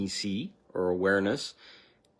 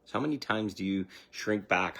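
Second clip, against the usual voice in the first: ordinary talk, nothing marked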